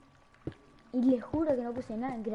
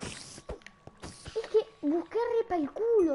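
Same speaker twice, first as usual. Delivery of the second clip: drawn-out mock complaint; 10 semitones higher, 4 dB louder, much slower than usual. Complains about luck.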